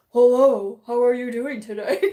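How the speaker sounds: loud, booming phone voice; exaggerated husband-on-the-phone impression; Mitch. Shown as a demonstration. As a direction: put on a hammed-up telephone hello. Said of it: "Here's phone Mitch".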